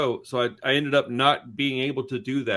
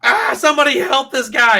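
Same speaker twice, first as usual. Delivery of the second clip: high, loud mock scream for help; hammed-up panicked yell; imitated screaming; 10 semitones higher, 9 dB louder than usual. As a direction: shriek for help as the alarmed passenger. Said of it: "this lady just starts screaming".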